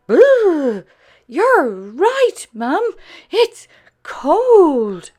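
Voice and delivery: high, loud little puppy. A narrator's reading. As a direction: squeal it like a chilly young pup. Spot feels cold outside.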